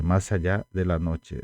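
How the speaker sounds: ordinary talk, nothing marked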